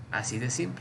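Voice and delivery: usual speaking voice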